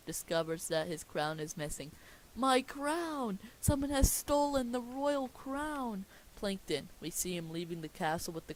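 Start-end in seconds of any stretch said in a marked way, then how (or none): 2.3-6.1 high, drawn-out cry of outrage; alarmed royal outcry. Acted. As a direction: cry out as a robbed king. King discovers crown is stolen.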